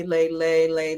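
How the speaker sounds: ordinary talk, nothing marked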